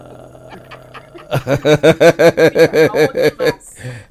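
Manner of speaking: long run of chuckling laughter. Real, not acted. Host reacting to a joke in the studio, laughing.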